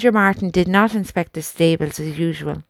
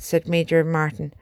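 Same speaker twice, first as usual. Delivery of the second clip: usual speaking voice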